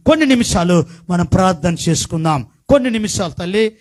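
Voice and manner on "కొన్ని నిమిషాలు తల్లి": high, loud sermon cadence; fiery preaching. Acted. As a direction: preach it out to the congregation. Preaching into prayer.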